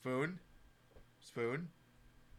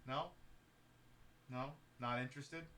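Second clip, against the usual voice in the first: ordinary talk, nothing marked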